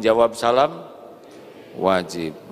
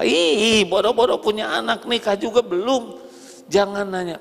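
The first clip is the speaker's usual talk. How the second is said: indignant retort; 9 semitones higher than usual. Pretend. Single woman reacts indignantly.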